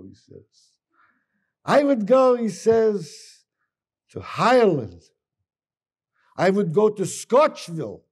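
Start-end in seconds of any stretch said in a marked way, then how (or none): 1.7-2.4 high, booming announcement; acted boast, loud and pitched up; loud proclamation. Character proudly announces his dream trip. 4.1-8.0 booming dream-trip announcement; acted boast, punched out; loud proclamation. Character proudly announces his dream trip.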